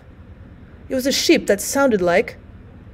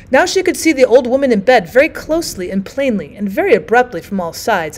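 ordinary delivery

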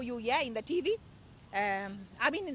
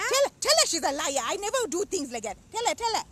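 louder, higher indignant protest; indignant outburst; 5 semitones higher, 7 dB louder than usual. Acted. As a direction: yell back at the accusation. Comic quarrel with sister-in-law.

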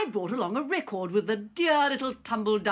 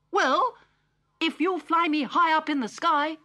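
Digby; 9 semitones higher than usual. Acted. Digby asks Polly to fly him high, high and eager. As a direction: pitch it up eager as the robot.

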